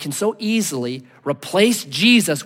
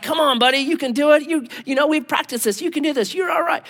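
higher-pitched encouraging father; coaxing dad; 7 semitones higher than usual. Retold or imitated. Dad to three-year-old on the diving board, coaxing. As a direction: imitate a dad talking a reluctant child into it. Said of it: "the dad happened to be there that day".

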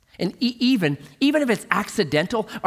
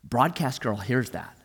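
ordinary delivery

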